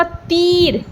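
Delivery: "तीर" with the ee pulled long; slow "तीर" showing the long vowel; stretched ee. Shown as a demonstration. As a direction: draw out the ee in "तीर". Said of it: "खींच के हम ई को बोल रहे हैं".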